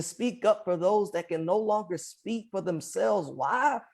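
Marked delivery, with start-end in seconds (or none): none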